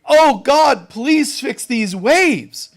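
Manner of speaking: loud, high, slow begging; mock pleading. Imagined Jesus praying as a victim, loud slow pleading. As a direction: beg God like a frightened victim. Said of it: "Jesus doesn't say".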